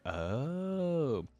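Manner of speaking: ordinary talk, nothing marked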